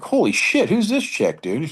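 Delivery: high, loud amazed outburst. Retold or imitated. Watching a girl clear the jump, high amazed outburst. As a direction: blurt it in disbelief. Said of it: "I was like".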